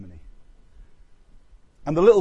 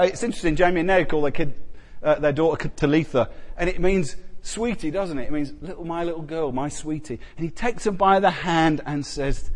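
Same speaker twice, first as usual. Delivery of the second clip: ordinary talk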